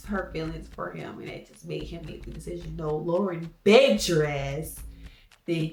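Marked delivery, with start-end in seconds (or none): 2.8-4.8 slow, loud, emphatic jab; blunt slow comeback; loud slow retort. Real, not acted. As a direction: hit every word, slowly.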